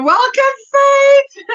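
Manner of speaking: high, loud name call; excited name call. Real, not acted. Thanking a viewer by name, high and loud. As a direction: call her name out brightly.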